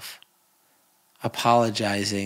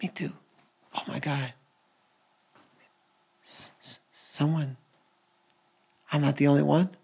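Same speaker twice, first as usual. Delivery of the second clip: quiet, higher, a touch tearful relief; soft relieved murmur; 3 semitones higher, 4 dB quieter than usual. Retold or imitated. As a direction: say it like someone finally not alone. Imitating relieved people.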